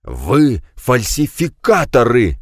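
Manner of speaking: slow, hoarse furious rasp. A narrator's reading. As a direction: rasp it out in rage, slowly. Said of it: "в бешенстве прохрипел Жиль". (хрипит в бешенстве, медленно)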